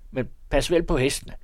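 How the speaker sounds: high, loud, busy voice of a priest in his carriage; fussy old priest calling. A narrator's reading. The priest invites Haring to his birthday, high and loud. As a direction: bustle and fuss, calling down from the carriage. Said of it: "råbte han".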